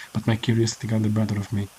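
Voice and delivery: ordinary delivery, nothing marked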